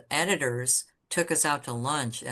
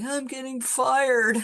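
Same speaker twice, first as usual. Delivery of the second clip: high, louder, drawn-out lament; mock wail; 10 semitones higher, 5 dB louder than usual. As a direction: wail it slowly in comic self-pity. Retold lament to friend on phone.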